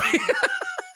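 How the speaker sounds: very high, squeaky laugh; high squealing laugh. Real, not acted. Moviegoer laughing at own verdict on the film, high squeal.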